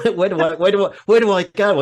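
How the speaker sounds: high, loud laughing plea; laughing at a hopeless career; laughing despair. Real, not acted. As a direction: throw up your hands through a laugh.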